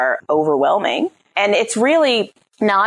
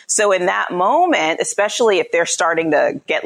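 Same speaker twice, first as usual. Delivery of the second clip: ordinary talk, nothing marked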